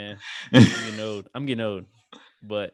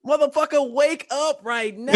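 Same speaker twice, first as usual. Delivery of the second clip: high, loud, slow mock yell; Samuel L. Jackson; 5 dB louder, slower than usual. Alarm app waking its owner, in a Samuel L. Jackson impression. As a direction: bellow a hammed-up wake-up order, words stretched out. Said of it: "a Samuel Jackson, you know, voice".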